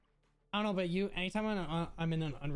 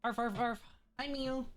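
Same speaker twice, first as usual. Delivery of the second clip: yappy chihuahua voice; 4 semitones higher than usual. Pretend. Dog noises greeting Mew.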